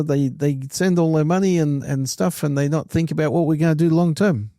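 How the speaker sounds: high and loud mock Kiwi rant; a friend's Kiwi accent, imitated. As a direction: scoff at America like your Kiwi-voiced mate. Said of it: "it sounds like a Kiwi accent".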